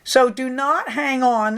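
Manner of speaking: usual speaking voice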